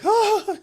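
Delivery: high, loud imitated gasp; mock gasp. Son imitates mother seeing police at the door, gasping.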